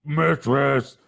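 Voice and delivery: gruff ogre call; short, forceful ogre exclamation. Acted. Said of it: "the ogre steps back, goes".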